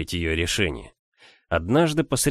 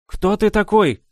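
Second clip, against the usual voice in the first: high, loud demanding question; commanding tone; 12 semitones higher, 5 dB louder than usual. A narrator's reading. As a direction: demand it like the mistress of the house. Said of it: "повелительным тоном".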